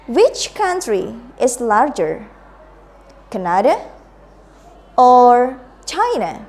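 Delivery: slow, with "Canada" rising and "China" falling; slow choice-question intonation. Shown as a demonstration. Teacher modelling for the class, slow rise then fall. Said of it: "now let's repeat after me again".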